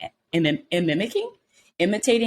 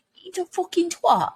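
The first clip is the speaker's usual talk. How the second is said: imitating a British insult, pitched much higher; British accent; 13 semitones higher than usual. Admiring British TV swearing, mock British accent. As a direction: toss it off like a British tough guy. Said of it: "having them just throw that around".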